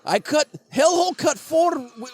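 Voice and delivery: put-on Russian accent, boastful. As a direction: sell the album like a proud Siberian. Russian character promotes album.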